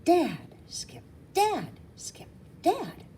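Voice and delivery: high breathy whisper; thought voice. A narrator's reading. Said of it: "I can read her only thought".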